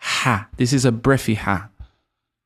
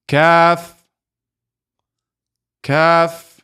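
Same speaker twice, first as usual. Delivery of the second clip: high, loud, drawn-out letter names for repeating; drilling letters; 5 semitones higher, 4 dB louder, much slower than usual. Shown as a demonstration. Teacher drilling the alphabet for students to repeat. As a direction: call each letter clearly for the class. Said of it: "So repeat after me".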